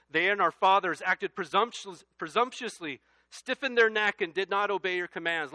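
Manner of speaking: loud, high scripture reading; preaching at full force. Acted. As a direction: proclaim the verse to the back row.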